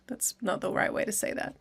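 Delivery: quick whispered self-correction; under-the-breath aside, almost to yourself. Real, not acted. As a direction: drop to a whisper, talking to yourself.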